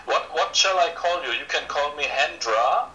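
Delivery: ordinary delivery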